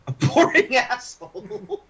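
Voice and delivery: laughing through the words; cracking up mid-answer. Real, not acted.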